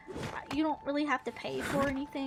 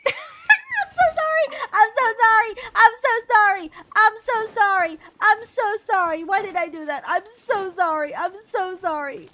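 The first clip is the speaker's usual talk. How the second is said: loud, much higher, shouted apology with laughter; frantic apologies; 6 semitones higher, 10 dB louder than usual. Real, not acted. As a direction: yell sorry in a guilty panic. Panicked apology to game creature.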